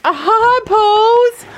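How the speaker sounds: high, slow sing-song greeting; high sing-song call. Real, not acted. Calling to pig Posey.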